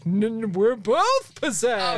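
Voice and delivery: slow, stammering fake possession; mock possessed. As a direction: ham up being possessed. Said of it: "you're both like".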